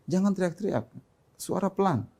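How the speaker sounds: put-on hushed admonishment to a child; soft parental reminder. Parent telling child to lower voice. (menegur pelan)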